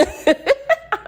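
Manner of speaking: high burst of laughter; real amused laugh; big laugh. Real, not acted. Laughing at own joke.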